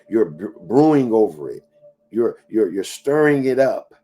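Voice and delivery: ordinary delivery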